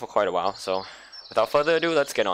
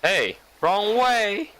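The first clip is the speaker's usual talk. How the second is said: put-on high, drawn-out call of a girl; 9 semitones higher, much slower than usual. Game girl calling to the lost newcomer, high and slow.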